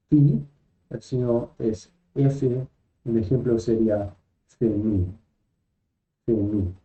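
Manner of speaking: ordinary talk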